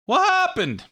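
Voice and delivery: high, squeaky what happened; comic catchphrase. Retold or imitated. Host imitating a TV comedian's catchphrase, high and squeaky. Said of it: "Who's the guy that always says that?".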